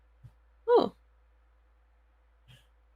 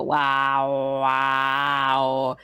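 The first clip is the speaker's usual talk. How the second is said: wavering fan-chopped voice; 6 semitones lower than usual. Retold or imitated. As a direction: talk into a spinning fan. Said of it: "lean into the fan and you go".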